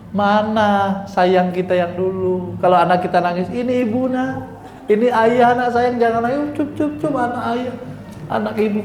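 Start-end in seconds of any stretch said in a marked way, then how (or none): 3.5-8.8 put-on cooing to a baby. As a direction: hush a crying baby tenderly. Parent soothing crying baby.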